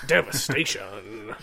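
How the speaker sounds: drawn-out dramatic trailer voice; slow announcer voice. Acted. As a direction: stretch it like a movie trailer. Echoing title, slow, far.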